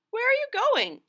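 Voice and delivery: very high, loud critter voice; a curious little creature calling out; squeaky high question; Harry Clary's voice. Acted. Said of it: "asked Harry Clary".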